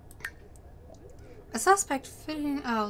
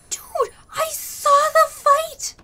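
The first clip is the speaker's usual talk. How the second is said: excited witness; 13 semitones higher, 5 dB louder, slower than usual. Acted.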